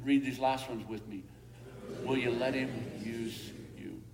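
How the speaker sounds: usual speaking voice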